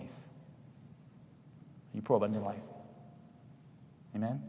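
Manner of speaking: very slow, breathy whisper. Shown as a demonstration. Preacher leaves the congregation with a whispered final challenge.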